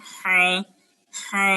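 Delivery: হা drawn out from mid-throat; throaty ha. Shown as a demonstration. Teacher recalling a hard letter, effortful throaty ha. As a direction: squeeze হা from the middle of the throat. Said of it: "এই হা উচ্চারণ করছিলাম".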